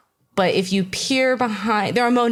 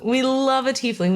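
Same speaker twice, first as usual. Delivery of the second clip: gushing; 6 semitones higher than usual. Acted. Joking about tieflings.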